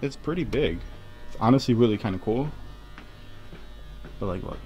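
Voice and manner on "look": ordinary talk, nothing marked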